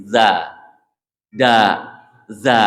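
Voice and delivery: Za with the tongue between the teeth, Da with a plain d, then Za again; za versus da. Shown as a demonstration. Teacher drilling the contrast of two letters, careful demo. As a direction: alternate za and da clearly. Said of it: "perbedaannya kalau za dengan da".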